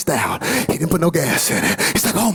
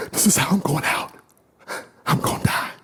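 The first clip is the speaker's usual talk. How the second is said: dying whisper. Acted. Freezing bird's last thoughts.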